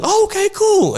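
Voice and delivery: put-on high, quick kid reply; a small, high-pitched okay. Kid answering the DJ in a high voice.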